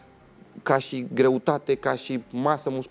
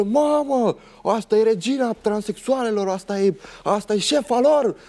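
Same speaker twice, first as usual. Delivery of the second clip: much higher, mock jeering shout; retold yelling; 8 semitones higher than usual. Aggressor yelling at the speaker, mock shouting taunt. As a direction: shout it across the mall to mock. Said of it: "Începe și urlă".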